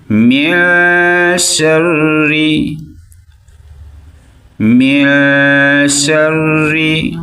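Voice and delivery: slow melodic tajweed chant; quranic recitation. Shown as a demonstration. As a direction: recite slowly, drawing out each vowel. Recites verse slowly. (धीमी सुरीली क़िरात)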